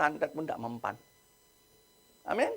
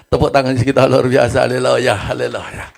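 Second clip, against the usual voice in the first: much louder, jubilant call to applause; rousing cheer; 4 semitones lower, 13 dB louder than usual. Real, not acted. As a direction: whip the congregation into applause. Preacher rouses the congregation.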